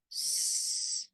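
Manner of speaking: voiceless "S", just air; s sound. Shown as a demonstration. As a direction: hiss a long "S" as in sun, no voice. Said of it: "Remember to say s".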